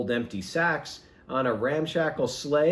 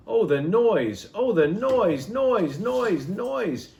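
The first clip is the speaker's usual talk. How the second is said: higher and louder, building on each noise; mounting noise; 8 semitones higher, 4 dB louder, slower than usual. A narrator's reading. Narrator mimicking the noise the Grinch hates, rising exasperation.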